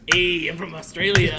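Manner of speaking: bad Australian accent. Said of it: "Can you do an Australian accent in your Mexican accent?".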